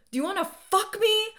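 high, furious scream at full volume. A narrator's reading. Enraged woman confronting a man who violated her, screaming. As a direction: scream it as a raging challenge. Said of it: "I screamed".

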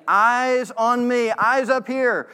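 a choir director drawing every eye up; director calling the choir. Retold or imitated. Choir director calling singers to look up, firm. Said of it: "like a director looking at the choir".

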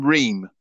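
Ream said as "reem", silent final s; British mispronunciation. Shown as a demonstration. Host showing listeners how Brits name the team, Ream as "reem". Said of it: "Many British people would call this team".

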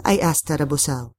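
ordinary delivery

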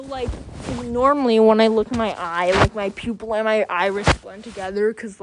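ordinary talk

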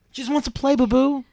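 put-on high, sing-song little girl voice; creepy little girl. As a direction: coo it like a ghost child. Voicing ghost girl sweetly.

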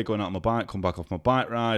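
ordinary talk

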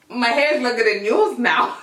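exaggerated boastful jeer; mock bragging schoolgirl. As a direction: imitate a girl showing off her hair. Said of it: "then they'll be like".

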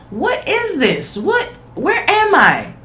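high, slow, bewildered; high drawn-out what is this; stunned disbelief. Real, not acted. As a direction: ask like you woke up on another planet. Shocked disbelief at the video.

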